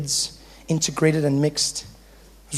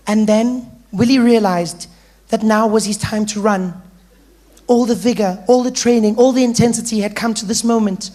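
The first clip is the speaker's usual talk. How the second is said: loud, high, over-earnest read-aloud; reading with vigor; 6 semitones higher, 6 dB louder than usual. Acted. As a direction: declaim it like the keenest pupil in class. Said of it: "She would read with vigor".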